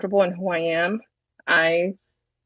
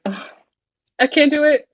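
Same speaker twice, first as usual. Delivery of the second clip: mock-overwhelmed groan, then high, louder plaint; 8 semitones higher, 4 dB louder than usual. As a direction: act out giving up, pitched high and louder. Voicing someone overwhelmed by divorce.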